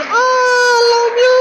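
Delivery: very high, slow, tearful cry of I love you; a wife overwhelmed and crying out her love; wife's tearful cry. Retold or imitated. Wife reading the surprise letter, crying out very high and slow. Speaker repeats wife's words.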